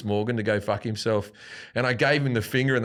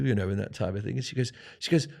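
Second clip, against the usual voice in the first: ordinary talk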